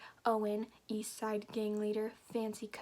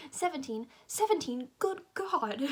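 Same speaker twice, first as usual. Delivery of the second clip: higher, mock-British exclamation; put-on British, building louder to the end; British accent; 5 semitones higher, 5 dB louder than usual. Reader voicing Uncle Anton hearing her age, British accent. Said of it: "do I have to do a British accent?".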